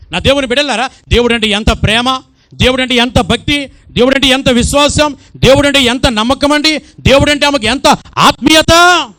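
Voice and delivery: high, loud building exclamations; full-force preaching. Acted. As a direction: climb to a passionate peak.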